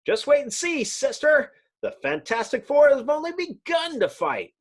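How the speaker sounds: high, brash hero voice; cocky young hero, pitched well up; Human Torch. Acted. Torch reassuring his sister, high and bold.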